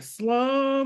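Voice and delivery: "slow" stretched long on a held pitch; drawn-out SLOW. Real, not acted. Mother describing a hand's motion, "slow" drawn out. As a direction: stretch the vowel of "slow" to picture it.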